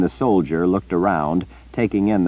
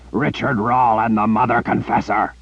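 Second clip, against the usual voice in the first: roared in raw fury; bellowing rage; 4 semitones higher than usual. Acted. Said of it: "bellowed in unrestrained rage".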